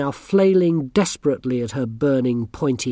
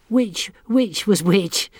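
cheerful; 7 semitones higher, slower than usual. A narrator's reading. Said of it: "said one of the girls cheerfully".

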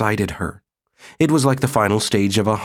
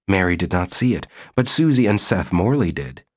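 ordinary delivery, nothing marked

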